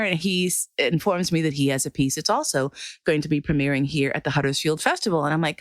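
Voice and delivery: ordinary talk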